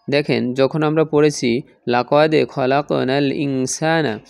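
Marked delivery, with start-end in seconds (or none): none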